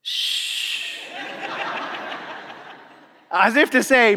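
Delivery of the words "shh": unvoiced shh, finger to lips; a soft secret shush. Boy hushing the pastor to keep the secret, shushing. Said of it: "he brings it up to his mouth and he goes".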